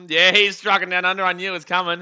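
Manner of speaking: loud, hyped-up burst; real excited outburst; excited cheer. Real, not acted. Celebrating his team's win.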